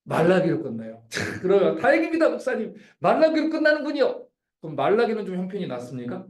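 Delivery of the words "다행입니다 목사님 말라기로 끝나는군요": lightly voiced relieved churchgoer, higher; a hint of a cheerful congregant; mock relieved listener. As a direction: play the congregation sighing in relief.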